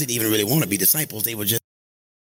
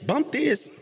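dismissive; 10 semitones higher than usual. Shown as a demonstration. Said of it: "Everybody say".

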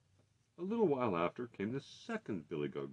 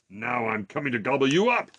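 loud, menacing roar; troll's roar; 3 semitones higher, 8 dB louder than usual. A narrator's reading. Troll threatening to eat the big goat, roaring. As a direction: roar the threat, rising at the end. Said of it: "roared the troll".

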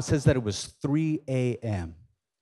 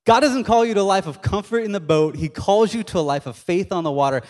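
raised, forceful declaration; emphatic preaching; 4 semitones higher, 3 dB louder than usual. Real, not acted. Preacher repeats his key point to the congregation, emphatic and raised. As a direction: drive the key line home.

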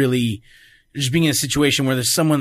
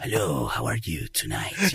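put-on low, slow Spanish accent; Antonio Banderas; 13 semitones lower, 8 dB quieter than usual. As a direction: suave Spanish accent, dropped deep and drawn out. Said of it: "have, like, a cool accent".

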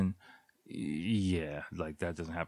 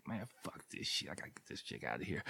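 much softer, whispered grumble; fed-up mutter; 13 dB quieter, faster than usual. Retold or imitated. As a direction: mutter it under your breath, done. His fed-up inner thought.

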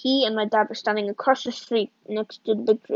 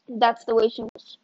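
ordinary delivery, nothing marked

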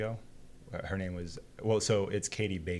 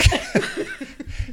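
high burst of laughter; 6 dB louder than usual. Real, not acted. Laughing at own story.

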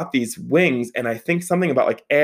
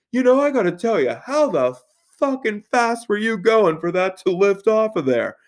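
higher-pitched, incredulous father voice; mock exasperated dad; 8 semitones higher than usual. Dad arriving in the driveway with his buddy, exasperated. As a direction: imitate a dad demanding an explanation.

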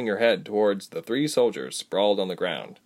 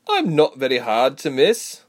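ordinary delivery, nothing marked

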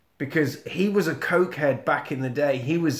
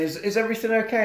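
quick, higher-pitched polite query; policeman at door; 7 semitones higher, much faster than usual. Acted. Policeman checking on the house, quick high-pitched query. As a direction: ask it fast and high.

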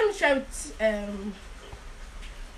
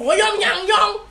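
higher, mock Calabar South patter; Calabar South accent; 5 semitones higher, slower than usual. Shown as a demonstration. Showing hosts how Calabar South people talk, exaggerated accent. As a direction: imitate Calabar South people slipping in their language. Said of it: "Calabar South people, they would always put".

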